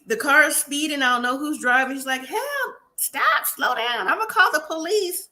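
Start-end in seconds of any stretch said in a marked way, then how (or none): 2.2-5.3 high-pitched cry for help without real volume; mock alarm. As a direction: act out a panicked passenger, pitched way up. Retold passenger in a speeding car.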